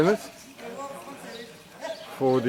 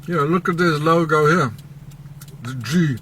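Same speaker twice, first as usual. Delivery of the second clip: ordinary talk, nothing marked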